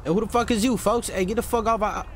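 ordinary talk

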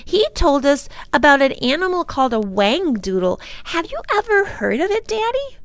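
put-on high-pitched excited little girl; high, eager child asking; little girl Lindy, eager. A narrator's reading. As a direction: tell Daddy eagerly in a small child's voice.

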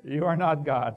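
voice of God. Acted. God answering the boaster.

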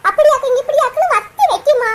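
ordinary talk, nothing marked

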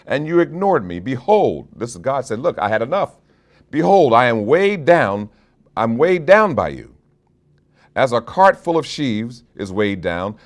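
high, loud preacher thundering God's rebuke; preaching at full force. Acted. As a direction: proclaim it like God fed up with his people.

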